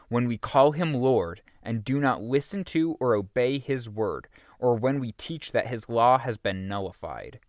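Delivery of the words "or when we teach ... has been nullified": usual speaking voice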